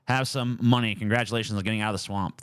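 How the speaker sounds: usual speaking voice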